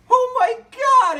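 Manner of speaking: very high, slow gush of delight; delighted squeal. Acted. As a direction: squeal like you can't believe it. Surprise reunion in the line.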